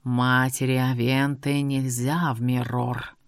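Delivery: low, slow, otherworldly warning; eerie djinn voice. A narrator's reading. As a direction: intone it like a spirit. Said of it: "Растянуто прозвучало".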